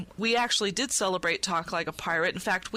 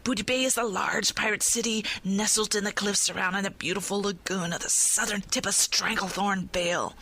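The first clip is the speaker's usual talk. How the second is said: ordinary delivery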